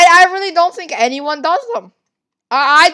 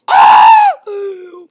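loud, high-pitched gasp; shocked gasp; 7 semitones higher, 8 dB louder than usual. Real, not acted. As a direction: gasp loudly in astonishment. Stunned by Saitama sighting.

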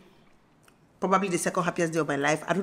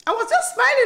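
high and fast, tumbling words; breathless excitement; 10 semitones higher, 3 dB louder, faster than usual. Real, not acted. Recounting the birth to viewers, high fast excitement. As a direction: rush it out, thrilled.

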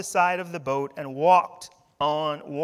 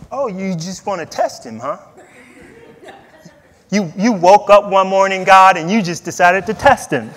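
mock indignation; 13 dB louder than usual. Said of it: "God decided to test Abraham".

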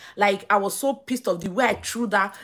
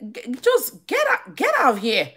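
mad dismissal; 4 semitones higher than usual. Retold or imitated. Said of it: "I was so mad now".

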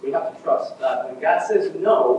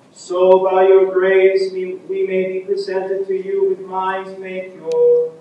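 higher, louder, chanted liturgical prayer; intoned prayer; 4 semitones higher, 8 dB louder, slower than usual. Acted. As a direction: intone it slowly like a priest at the altar. Priest intones the opening prayer.

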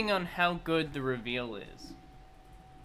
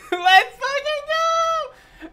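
very high, drawn-out loud cheer; ecstatic scream; 8 dB louder, much slower than usual. Real, not acted. Streamer celebrating Steve's reveal, ecstatic scream. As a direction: stretch it into a screaming cheer after laughing.

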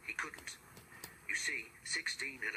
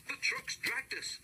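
explaining driver; 13 semitones higher than usual. A narrator's reading. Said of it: "explained the driver".

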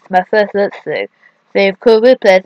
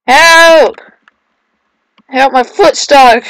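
loud, raised-pitch play-acted plea; mock cry for help; 5 semitones higher, 7 dB louder, slower than usual. Player stuck in a game calls for help, mock distress. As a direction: call out in pretend distress.

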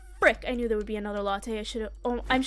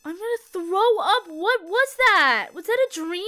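raised, stretched-out horrified questions; shaken disbelief; 6 semitones higher, 5 dB louder than usual. Real, not acted. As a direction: wail it in the wake of a scare. Shaken right after the scare.

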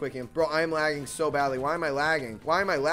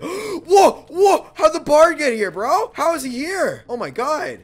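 startled shout, much higher and louder; shocked yell; 12 semitones higher, 12 dB louder, slower than usual. Real, not acted. Enemy suddenly appears.

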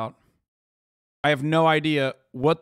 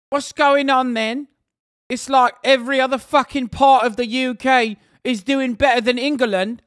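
high, loud British slang voice; put-on British accent; 8 semitones higher, 6 dB louder than usual. Streamer hails Welsh basic income trial, high put-on British accent.

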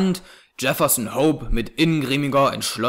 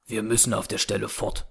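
softer, resolute urging; young man urging the family to flee at once, quiet and firm; quiet but firm; 4 semitones lower, 6 dB quieter than usual. A narrator's reading. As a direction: say it low and steady, no doubt in it. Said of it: "mit leiser, aber fester Stimme".

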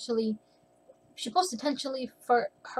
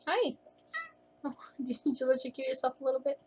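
cooing to the cat; 2 semitones higher, 4 dB quieter than usual. Real, not acted. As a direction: sweet-talk the cat gently.

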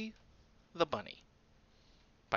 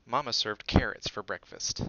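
ordinary delivery, nothing marked

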